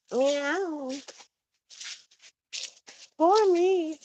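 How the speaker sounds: high mock-pitiful meow; mock cat. Owner teasing her crying cat with a high mock meow. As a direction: tease the whiny cat with its own cry.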